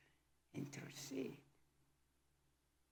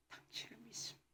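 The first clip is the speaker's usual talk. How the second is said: breathy whispered confession; hushed, intimate 믿습니다 almost without voice; 16 dB quieter, faster than usual. Shown as a demonstration. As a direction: whisper 당신을 믿습니다 as a private prayer. Same confession repeated as whisper.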